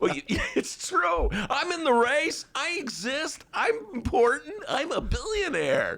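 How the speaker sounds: high, slow self-important voice; pompous billionaire parody; mock Tom Steyer. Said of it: "Steyer voiced".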